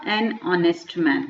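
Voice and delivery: each word laid out slowly; a slow, careful teacher's example; slow model phrase. Shown as a demonstration. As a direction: say it slowly for students to copy. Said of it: "ओ बोलते हैं".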